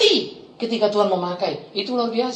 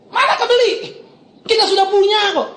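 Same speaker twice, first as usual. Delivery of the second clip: high, laughing triumphant cry; 10 semitones higher than usual. Real, not acted. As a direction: exult over the gift. Rhetorical triumphant exclamation. (seruan gembira yang tinggi)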